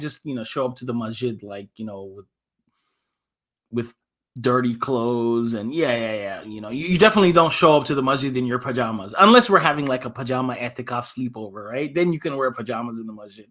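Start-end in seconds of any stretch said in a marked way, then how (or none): none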